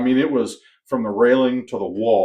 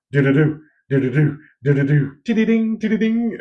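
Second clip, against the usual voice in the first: higher, louder mouthed burst of gunfire, then ringing steel hits; gunfire noises; 5 semitones higher, 5 dB louder than usual. Retold or imitated. Demonstrating to a friend how the gun and steel targets sounded, imitated gunfire. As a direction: rattle off the bursts and pings.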